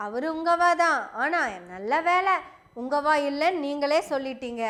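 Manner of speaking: ordinary talk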